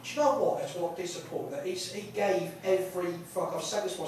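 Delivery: ordinary talk, nothing marked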